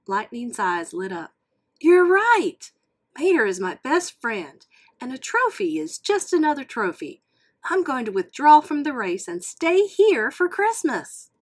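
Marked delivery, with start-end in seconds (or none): 1.8-11.3 excited. Acted. Lightning decides to stay home.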